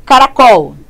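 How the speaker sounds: Caracol with the final syllable COL stressed, loud; stress on last syllable. Shown as a demonstration. Teacher showing the tonic syllable, stress on COL in Caracol. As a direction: land firmly on the COL of Caracol. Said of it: "A sílaba tônica tá aqui".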